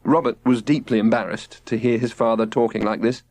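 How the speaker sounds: usual speaking voice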